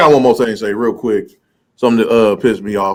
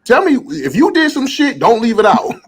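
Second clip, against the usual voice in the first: worked-up plea; 8 semitones higher than usual. Real, not acted. To storytellers who leave things out, worked up. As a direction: demand the whole story. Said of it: "S3 builds up excitedly".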